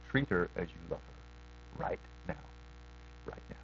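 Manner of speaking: low, hushed, fading almost to nothing; soft inner voice. Retold or imitated. Pastor recalling the quiet inner voice urging him to love his wife, hushed. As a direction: let the inner prompt sink in quietly.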